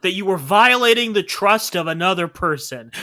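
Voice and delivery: hammed-up betrayed lover, loud and high; mock outrage. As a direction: play the wronged boyfriend for laughs. Cohost mock-outraged as the boyfriend.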